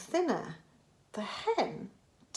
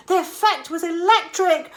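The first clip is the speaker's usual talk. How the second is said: usual speaking voice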